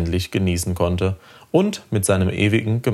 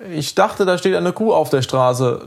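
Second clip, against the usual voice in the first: put-on high-pitched boy making an excuse; boy's hasty lie; 9 semitones higher than usual. A narrator's reading. Boy lying to his angry father, high hasty excuse. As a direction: blurt the excuse in a higher voice. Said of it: "log Lenny schnell".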